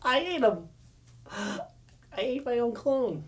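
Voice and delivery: high-pitched confession after a pause; Dinobot. Acted. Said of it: "Dinobot's like".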